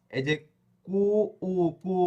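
usual speaking voice

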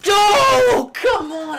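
sudden high, loud cry of dismay; frustrated outcry. Real, not acted. Gamer yelling at losing all his diamonds, loud dismay. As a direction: yell it out as the diamonds are lost.

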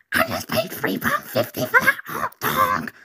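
a mum losing it over a stolen hot dog, slow and shrill; furious mum flipping out. Retold or imitated. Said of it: "completely flips out".

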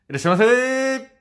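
high, loud shopkeeper welcome; shop greeting. Pretend. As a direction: sing out like a shop clerk. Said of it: "お店みたいにしようかなと思いまして". (店員風の元気な呼び込み)

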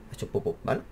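breathy hushed aside, unvoiced; soft whisper. Real, not acted. Camera fails, playful childish remark.

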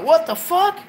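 high shocked yelp; shocked what the fuck. Retold or imitated. To the girl opening his mom's door, a high startled cry. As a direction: caught off guard at the door, voice jumping up. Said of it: "and I'm like".

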